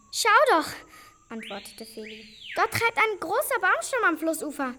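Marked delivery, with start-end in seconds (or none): 0.1-0.7 high, loud call to look; excited call. Acted. Brother or sister pointing out an escape, excited call. Spotting an escape while fleeing. 2.5-4.7 high, loud, urgent discovery; excited announcement. Acted. Child spots a floating log while fleeing, excited shout.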